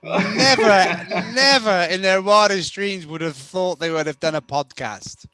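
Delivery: high and loud, still laughing; excited emphasis. Real, not acted. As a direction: push through the giggles. Laughing excitement after teasing.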